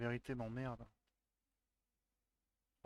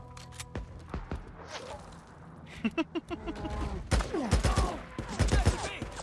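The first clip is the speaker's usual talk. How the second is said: burst of laughter; 4 dB louder than usual. Real, not acted. Streamer startled mid-fight.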